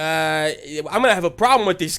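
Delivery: pushy complaining chimp character; acted grumbling tough-guy chimp; chimp character Chucky. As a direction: lodge a rough, urgent complaint with the doc. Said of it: "Chucky ... i-, is like".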